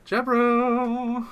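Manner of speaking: hammed-up catchphrase from a cartoon; South Park impression. Streamer mimicking South Park to his co-hosts, hammed-up high cartoon voice. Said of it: "that thing from South Park".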